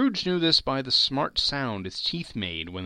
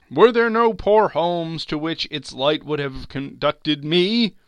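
slow, raised voice of a grieving spectre; mournful ghost, growing louder and higher; lamenting ghost; 6 semitones higher, 7 dB louder than usual. Acted. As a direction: lament with drawn-out words, swelling on the questions.

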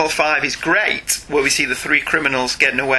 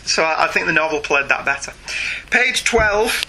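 usual speaking voice

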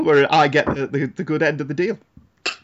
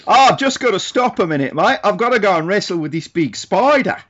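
hammed-up Aussie accent, higher-pitched; put-on Australian accent; 4 semitones higher than usual. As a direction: show off a mock Australian bushman's accent. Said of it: "more than Australia".

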